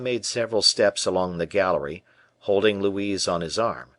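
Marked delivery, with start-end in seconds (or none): none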